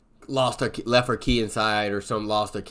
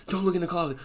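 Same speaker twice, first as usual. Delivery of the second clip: scared warning; 8 semitones higher, faster than usual. Retold or imitated. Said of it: "Allie's like".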